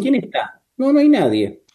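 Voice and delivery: high-pitched, puzzled questioning and answer; acted puzzlement. Someone looks for who is insulting them, acted puzzlement. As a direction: act out looking for someone, pitched well up. Acts out looking round, finding nobody.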